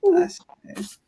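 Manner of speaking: soft breathy whispered aside. Real, not acted. Reader whispering an aside to her laughing guest. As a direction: drop to a whisper for a quick private comment.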